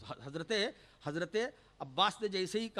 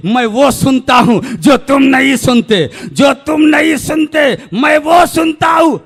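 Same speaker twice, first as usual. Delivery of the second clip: impassioned sermon shout; fervent preaching; 3 semitones higher, 21 dB louder than usual. Acted. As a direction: proclaim the verse to the congregation. Arabic recitation then shouted translation.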